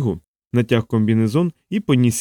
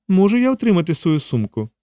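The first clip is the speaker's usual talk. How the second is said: lightly voiced woman, higher pitch; woman's request; 7 semitones higher than usual. A narrator's reading. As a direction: ask in a lifted character voice. Жінка вимагає повернути сумку. (легко озвучена жінка, вищим голосом)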